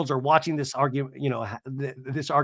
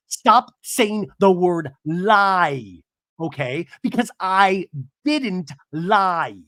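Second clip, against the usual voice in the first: very slow, word by word; really enunciating; 4 dB louder, much slower than usual. Retold or imitated. Heather rebukes Katie for saying lie, slowly over-enunciating. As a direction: drag out each word with heavy emphasis. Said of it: "like, really enunciating".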